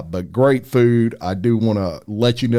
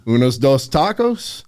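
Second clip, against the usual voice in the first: playful attempt at a Spanish accent; best Spanish accent; 3 semitones higher than usual. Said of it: "In my best, uh, Spanish accent".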